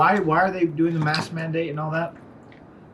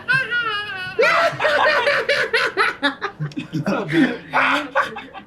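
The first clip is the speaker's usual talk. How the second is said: high shrieking clown cackle; wild maniacal clown laugh. Pretend. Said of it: "best, like, clown laugh".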